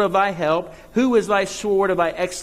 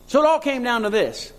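ordinary delivery

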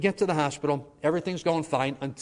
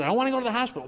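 put-on much higher, pleading old woman; frightened grandma; 10 semitones higher, much faster than usual. Grandma refusing the hospital, high frightened plea. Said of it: "she says".

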